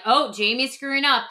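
usual speaking voice

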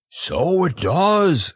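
slow, high bear voice; hearty, stretched-out wonder; admiring Pooh. A narrator's reading. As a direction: marvel with drawn-out "does". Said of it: "said Pooh".

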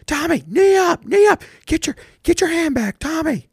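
dad coach screaming pitching tips at Tommy, high and loud; imitated shout. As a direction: holler it across the field. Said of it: "he would just yell at him".

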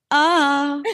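high, drawn-out "ah"; mock moan. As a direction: moan for a performance piece, hammed-up. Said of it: "it's her just moaning".

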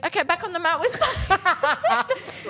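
high, slow, laughing instructor voice; mock instructor call. Narrator mocks the taekwondo lady calling kids back, laughing. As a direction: mimic her chirpy call through laughter.

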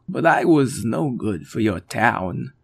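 slow, low sad alien; a gently downcast alien; Chris alien voice. Acted.

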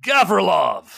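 loud call of a name; sudden loud shout of recognition. Acted. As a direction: shout his name across the train. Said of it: "he goes".

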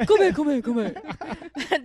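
laughing imitation. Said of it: "I like the dude".

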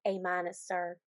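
low, soft Southern drawl. Retold or imitated. Podcaster quoting the trainee answering her boss, soft Southern drawl. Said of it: "the only thing we can say with the drawl".

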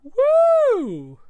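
very high whooping cheer. Real, not acted. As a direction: whoop for the winner. Cheering for the award winner.

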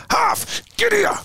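very high strained yell at a teammate; imitated yell. Teammate calling early for the ball, yelling. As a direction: holler it high across the field. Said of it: "he's yelling at me".

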